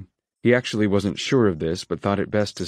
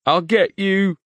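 sobbing; 11 semitones higher, 5 dB louder, slower than usual. A narrator's reading. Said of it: "New Kid sobbed".

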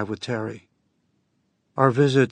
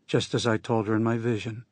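ordinary delivery, nothing marked

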